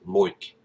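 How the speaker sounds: "loik" with oi as in oil; Aussie oi vowel. Shown as a demonstration. Showing an Aussie accent tip, "loik" with oi vowel. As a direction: say "loik", the I turned to oi. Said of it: "Treat that I sound like oi".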